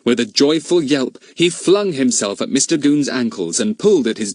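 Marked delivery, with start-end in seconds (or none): none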